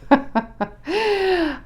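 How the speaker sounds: high, bubbling laugh; real amused laughter. Real, not acted. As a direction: burst out laughing, helplessly. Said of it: "I'm laughing because the first thing".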